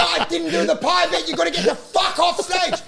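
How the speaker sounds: high, loud scolding; retold angry yell. Comic ordering pieman off stage, acted angry yell. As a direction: shout him off the stage.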